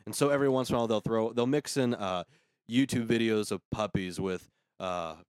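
ordinary delivery

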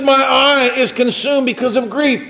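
high-pitched impassioned verse; anguished quote. Acted. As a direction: preach the grief with urgency. Quoting David's grief in preaching.

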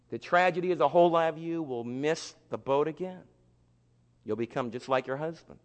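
usual speaking voice

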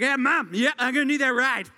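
put-on sloppy drunk begging mom for a ride; drunk son on the phone. Drunk adult son phoning mom.

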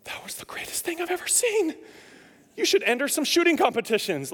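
high, breathless whisper of amazement; mock-awed friend whispering, then praising; imagined buddy's praise. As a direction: whisper it in awe, then gush over the shot. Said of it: "He's gonna be like".

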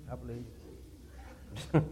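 under the breath, nearly silent; quiet. Acted. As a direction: drop it to a soft aside. Repeats it teasingly, almost silently.

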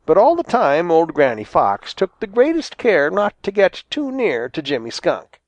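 usual speaking voice

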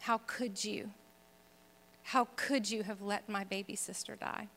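soft, pained retelling of a protest; hushed reproach. Retold or imitated. Grieving sister shaking her fist at God, in a hushed reproach. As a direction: accuse quietly, well under full voice. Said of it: "I shook my fist at God".

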